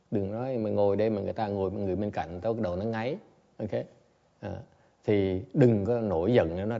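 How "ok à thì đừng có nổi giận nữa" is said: ordinary talk, nothing marked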